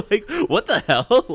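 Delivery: high, loud laugh into what the hell; laughing disbelief. Real, not acted. Vendor laughing about the kid who took the free print. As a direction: cackle out the question in disbelief.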